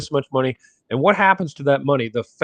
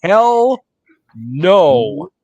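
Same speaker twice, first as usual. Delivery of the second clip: defiant hell no; 5 semitones higher, 5 dB louder, much slower than usual. Retold or imitated. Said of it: "of them would say".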